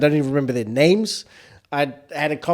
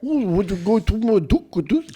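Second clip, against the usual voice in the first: high mock Kiwi accent; a hammed-up New Zealand voice; 7 semitones higher than usual. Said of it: "that's what they sounded like".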